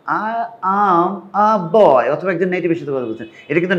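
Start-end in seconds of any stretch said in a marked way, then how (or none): 0.1-2.1 very slow, higher, each word stretched; talking to a child. Acted. Someone talking to a child, slow careful words. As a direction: spell it out slowly for a small child. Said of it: "একটা বাচ্চার সাথে কথা বলছে".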